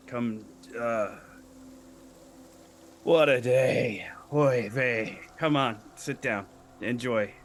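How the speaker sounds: lightly voiced grumbling old desk boss; a touch of cranky, sighing gnome; weary gnome boss. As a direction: wave the newcomers in with put-upon weariness. Game master plays the gnome boss.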